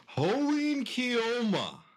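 drawn-out, high dramatic cry; Okabe catchphrase. Acted. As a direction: boom it out like a mad scientist. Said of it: "I want you to do".

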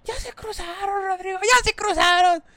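high, frantic yell of a scared mother; panicked shout. Retold or imitated. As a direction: cry out to the husband in alarm. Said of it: "mi mamá, pues, toda espantada". (madre espantada grita al esposo Rodrigo, aguda y alarmada)

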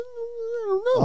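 very high, slow squeak; squeaky silly voice. Pretend. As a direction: stretch it up into a squeak.

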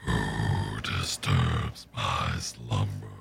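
very low, slow booming rumble; Cave of Wonders voice. Retold or imitated. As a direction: speak like a giant cave of stone. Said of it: "you've heard my Cave of Wonders".

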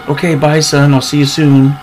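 called-out goodbye. Retold or imitated. Old lady waving to pretend son, called-out farewell.

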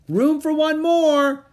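high, loud, drawn-out call; long, ringing high shout. A narrator's reading. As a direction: holler it slowly to someone across the deck. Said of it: "Annie shouted to Lady Blackwell".

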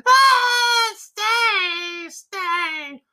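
very high, loud desperate scream; the mother begs her sons to stay, screaming high; screaming plea. A narrator's reading. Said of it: "screamed aloud and implored them to stay".

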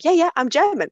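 deliberately annoying. Retold or imitated. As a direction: needle her with an emphatic yes. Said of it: "I really wanted to annoy her afterwards".

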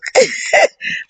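high giggle; laugh. Real, not acted. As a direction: giggle after a blunt punchline. Laughs after blunt quote.

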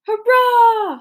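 loud, high shouted cheer; a roaring cry of joy; soldiers cheering. Acted. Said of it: "the soldiers shouted".

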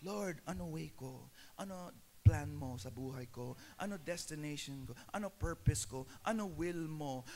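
soft, hushed prayer to himself; quiet inner prayer. Shown as a demonstration. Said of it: "as an inner focus".

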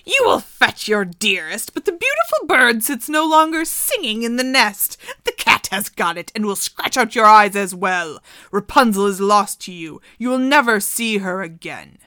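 loud, gloating witch voice; taunting witch. Acted. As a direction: gloat cruelly at the prince.

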